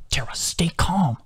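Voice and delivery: slow, urgent whispered order; angry whisper. A narrator's reading. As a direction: whisper it sternly, drawn out. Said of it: "Adam whispered angrily at her".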